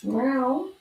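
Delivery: high playful meow; mock meow. Girl meowing to her cat at the door.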